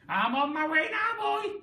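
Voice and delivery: high, loud call from above; Grandma calling down to George from the ceiling. A narrator's reading. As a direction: call down to the boy below. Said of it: "she called down to George".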